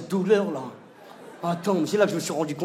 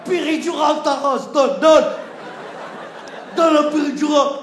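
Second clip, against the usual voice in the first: the wife turns street thug, demanding, higher and louder; Grenoble thug voice; 8 semitones higher, 7 dB louder than usual. Acted. The wife demands the epidural like a Grenoble thug, higher and louder. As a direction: bark it like a thug from the projects. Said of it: "Une racaille de Grenoble".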